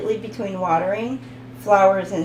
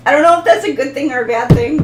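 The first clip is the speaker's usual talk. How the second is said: giggling through it, rushed and a bit louder; real laughter spilling into the words; laughing; 4 semitones higher, 8 dB louder, much faster than usual. Real, not acted. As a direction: talk fast while still laughing. Laughing at her own joke.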